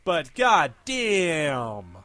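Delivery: very slow, stretched out exclamation; drawn-out exclaim. Real, not acted. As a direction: drag out the outrage. Outburst on eighties stereotypes.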